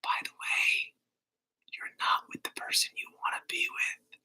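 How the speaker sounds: a sly little whisper, nearly silent; sneaky rascal whisper. Pretend. Tarot reader voicing the nagging Page card, whispered. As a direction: hiss it like a pesky imp in your ear. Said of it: "this little rascal who's like".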